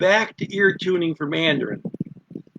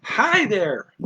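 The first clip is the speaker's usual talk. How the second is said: mildly excited greeting, pitched up then falling; a little excited; 5 semitones higher than usual. Shown as a demonstration. Teacher showing a milder greeting, a little bit excited. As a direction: lift the greeting with modest excitement. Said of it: "a little bit excited".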